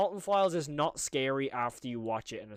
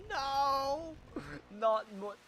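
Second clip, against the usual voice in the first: whiny no; 10 semitones higher, much slower than usual. Real, not acted. Horrified at more creepy paintings.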